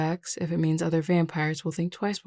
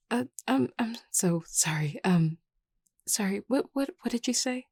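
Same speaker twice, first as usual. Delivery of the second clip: flustered; 5 semitones higher than usual. Acted. Distracted new leader apologizing to a friend, flustered. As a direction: fumble for words, caught off guard. Flustered, caught not listening.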